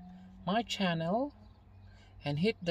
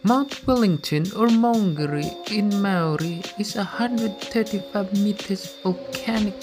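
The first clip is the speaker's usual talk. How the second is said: louder travel-show voiceover; documentary narration; 14 dB louder than usual. Acted. Documentary voiceover over music.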